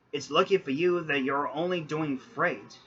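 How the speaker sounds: usual speaking voice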